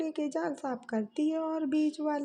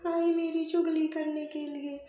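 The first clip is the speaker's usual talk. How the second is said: complaining daughter-in-law; 3 semitones higher, 4 dB louder than usual. Acted. Eldest bahu protests to her mother-in-law, loud and aggrieved.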